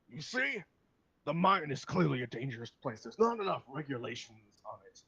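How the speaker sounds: loud, emphatic complaint in character; argumentative. Acted. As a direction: argue your case forcefully. Acted NPC quarrel about the mine.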